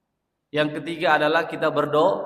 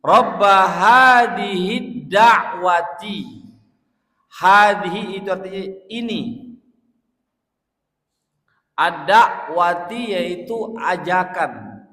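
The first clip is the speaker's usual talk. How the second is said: loud, slow, higher impassioned invocation; fervent preaching; 3 semitones higher, 7 dB louder, slower than usual. Acted. Preacher crying out the prayer to the congregation, loud and impassioned. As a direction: preach it out at full force.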